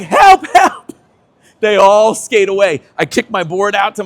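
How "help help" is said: high, loud mock plea for help; mock cry for help. Skater carried off by gangsters, calling to friends, mock cry for help. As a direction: squeal it out like a helpless kid.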